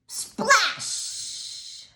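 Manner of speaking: high, loud breathy splash. A narrator's reading. Reader voicing the fish hitting the pool. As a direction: burst out the splash. Narrator reads sound word.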